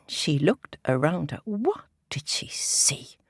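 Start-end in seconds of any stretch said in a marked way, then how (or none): none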